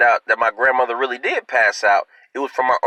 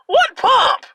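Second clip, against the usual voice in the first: baffled outburst; 4 dB louder than usual. Real, not acted. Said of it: "baffled outburst".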